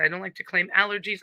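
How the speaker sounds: usual speaking voice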